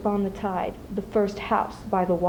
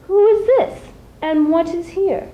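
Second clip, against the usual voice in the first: higher and louder, a startled outcry; startled cry; 9 semitones higher, 6 dB louder than usual. Acted. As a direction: call out in sudden alarm. Townsfolk cry out at the boat.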